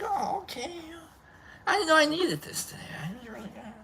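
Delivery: high, soft and breath-thin; mock touched. Reacting to an unexpected self-hug, mock touched. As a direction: act overcome in a tiny high hushed voice. Acting out being moved by hug.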